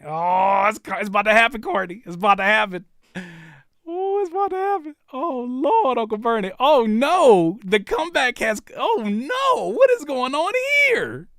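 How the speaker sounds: loud, high-pitched alarm, building; frantic live reaction. Real, not acted. Host watching the vote near forty, frantic.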